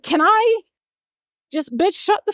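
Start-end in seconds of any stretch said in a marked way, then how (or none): 0.0-0.6 a hint of Mary cutting in; mock Mary. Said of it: "And then Mary's like".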